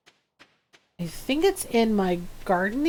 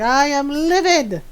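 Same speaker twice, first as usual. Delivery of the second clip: louder, higher playful outrage; mock furious; 5 semitones higher, 4 dB louder than usual. Furious animal telling off its farmer, mock outrage. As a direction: act livid, punched up and hammy. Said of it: "They're furious with me".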